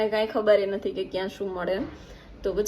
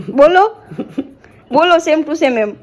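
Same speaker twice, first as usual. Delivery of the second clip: 1 insistent urging; 4 semitones higher, 12 dB louder, slower than usual. Real, not acted. Wife urging husband to speak.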